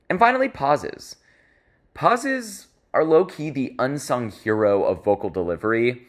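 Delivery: ordinary delivery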